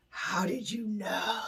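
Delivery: slow, hushed stage whisper; drawn-out, whispered astonishment; awed whisper. Acted. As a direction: whisper it in mock amazement. Awed model asks mind reader.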